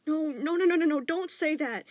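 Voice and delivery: high, loud, insistent no's; stern loud denial. A narrator's reading. Father firmly telling his son not to say that, loud. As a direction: shut down the idea firmly. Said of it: "his dad said sternly".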